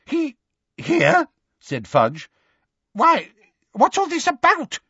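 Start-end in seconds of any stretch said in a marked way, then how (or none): 0.0-1.3 very high, halting question; squeaky, incredulous official; astonished. A narrator's reading. Fudge astonished at Harry's presence. 2.9-4.8 high, agitated questioning; squeaky, bewildered official; bewildered demand. A narrator's reading. Bewildered Fudge demands explanation.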